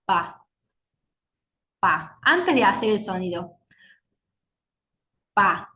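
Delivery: Pa said with no throat vibration; unvoiced p demo. Shown as a demonstration. Teacher has class feel no vibration on Pa. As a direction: feel the throat stay still on Pa. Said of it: "¿Hay una vibración?".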